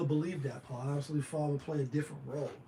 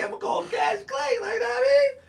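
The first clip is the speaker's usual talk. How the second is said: much higher and louder, yelling it out; excited shout; 8 dB louder than usual. Real, not acted. Hyping up the fight to viewers, excited high shout. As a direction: shout it with real hype. Hyped about the upcoming fight.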